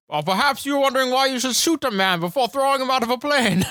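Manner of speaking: high mock Bane voice, theatrical; quoting Bane. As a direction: ham up the villain line. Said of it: "just saying them on repeat".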